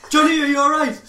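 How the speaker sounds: high, loud call up the street; mock shout. Bill calling to his pinned friend, mock shout. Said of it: "What does Bill shout?".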